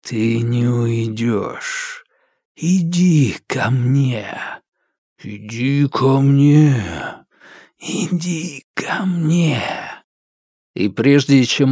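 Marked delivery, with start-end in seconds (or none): 0.0-2.0 eerie dark voice. Acted. Darkness calls the boy. 2.6-4.4 eerie whisper. Acted. Darkness calls, repeated. 5.2-9.6 eerie dark voice. Acted. Darkness calls the boy.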